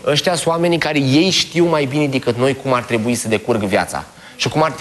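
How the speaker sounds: ordinary talk, nothing marked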